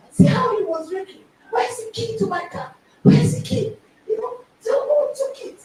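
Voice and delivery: high-pitched mock rant, bursts of volume; retold shouting husband. Husband hunting for his car key, mock shouting. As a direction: fire off agitated questions, pitched high. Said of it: "after all this shout".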